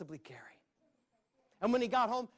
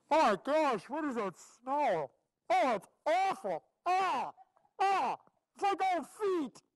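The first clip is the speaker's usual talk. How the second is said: high, loud, disgusted gasping at the stench; 8 semitones higher, 6 dB louder than usual. Acted. Jack in the cheese mine, hit by the stink, disgusted. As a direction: recoil from a foul smell, voice up high.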